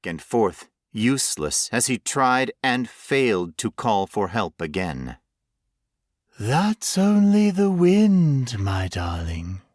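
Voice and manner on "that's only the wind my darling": lightly voiced monster, slow and coaxing; a hint of a menacing creature soothing its prey; creepy murmur. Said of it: "the voice murmured".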